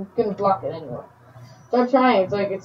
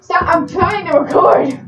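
loud higher shout to stop; annoyed yell; 7 semitones higher, 6 dB louder than usual. Real, not acted. Youtuber snaps at someone interrupting the recording, annoyed shout.

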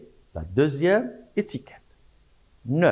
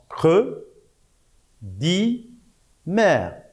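syllabe par syllabe; 6 semitones higher, much slower than usual. Shown as a demonstration. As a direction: separate syllables with pauses between. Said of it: "les syllabes sont". (syllabe par syllabe, bien détachées)

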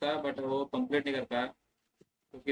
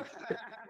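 breathy laugh; 10 semitones higher, 12 dB quieter than usual. Real, not acted. Friends teasing on stream.

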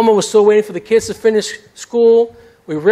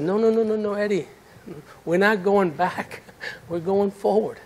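gentle God voice; 9 dB quieter than usual. Retold or imitated. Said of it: "God loved me too much".

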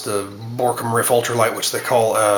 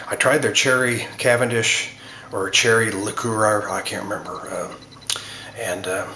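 ordinary talk, nothing marked

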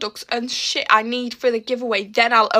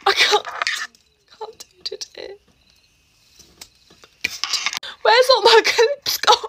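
high, strained whine; whiny panic; 9 semitones higher than usual. Real, not acted. Girl whines to viewers about her missing clips. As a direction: wail at the lost hair clips. Frantic getting ready.

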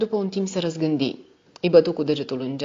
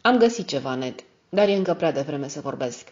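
usual speaking voice

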